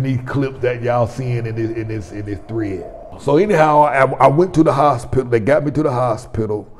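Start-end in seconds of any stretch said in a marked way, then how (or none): 3.2-6.7 loud. Real, not acted. Still fired up after preaching.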